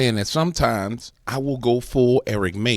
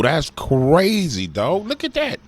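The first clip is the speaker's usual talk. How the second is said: amazed; 7 semitones higher, much slower than usual. Real, not acted. Amazed watching thermal footage.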